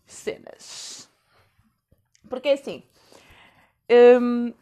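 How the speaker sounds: choking mid-sentence; words catch in the throat. Real, not acted. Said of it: "já começa a engasgar".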